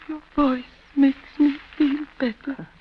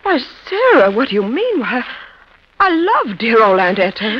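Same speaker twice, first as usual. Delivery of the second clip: gushing shocked protest; 8 dB louder than usual. Acted. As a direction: gasp out a sweet protest. Sister gushes innocent protest.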